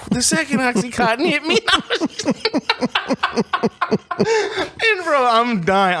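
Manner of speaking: cracking up, voice pitched high; can't stop laughing mid-story; laughing hard. Real, not acted. Telling buddy's mishap to his co-host, laughing hard. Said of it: "I'm in tears".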